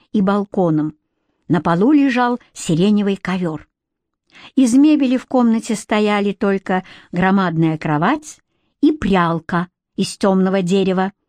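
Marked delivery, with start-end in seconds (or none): none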